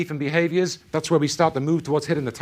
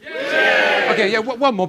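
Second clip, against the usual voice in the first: usual speaking voice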